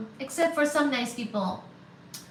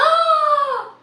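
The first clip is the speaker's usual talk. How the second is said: sharp high gasp of shock; real startled gasp; loud gasp; 14 semitones higher, 9 dB louder than usual. Real, not acted. Shocked by a donation.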